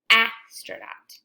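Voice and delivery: "astronaut" drawn out slowly, each sound apart; slow stretched word. Shown as a demonstration. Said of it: "Now I'll say it regular".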